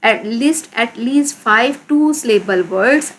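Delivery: very slow, word by word instruction; drawn-out teacher dictation. Shown as a demonstration. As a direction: dictate it slowly to the class. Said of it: "right करने हैं".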